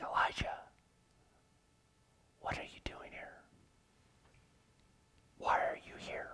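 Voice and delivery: hushed, breathy whisper of God; a delicate, still small voice whisper; low whisper. Acted. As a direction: barely whisper it, gently drawing him in. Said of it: "God's not yelling to Elijah. He's saying".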